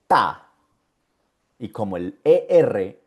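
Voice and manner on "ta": "ta" alone, short and falling; isolated syllable. Shown as a demonstration. Teacher giving the last syllable, falling "ta". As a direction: close on a lower, clear "ta". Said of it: "última sílaba".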